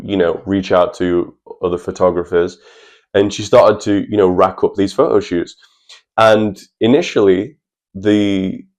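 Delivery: normal talking voice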